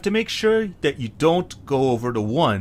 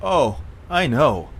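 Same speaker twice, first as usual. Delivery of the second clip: normal talking voice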